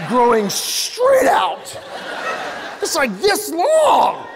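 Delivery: high, slow stretched shout; mock horror. Comic telling the crowd about an ear hair in mock horror. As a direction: drag it out in disbelief.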